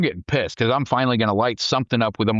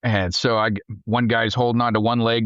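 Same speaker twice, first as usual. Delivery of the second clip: ordinary talk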